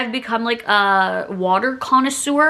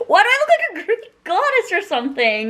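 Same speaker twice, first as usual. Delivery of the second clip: laughing; 8 semitones higher than usual. Real, not acted. Laughing at her own look.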